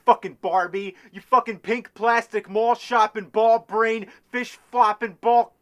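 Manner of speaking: loud, high-pitched furious outburst; exasperated gamer blowing up; angry rant. Acted. Angry retake of the insult rant.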